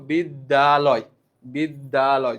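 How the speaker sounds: slow বিদ্যালয় broken into three beats; syllable by syllable. Shown as a demonstration. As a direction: sound out বিদ্যালয় in three pieces. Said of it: "এখন দেখো". (বিদ্যালয় ধীরে তিন ভাগে)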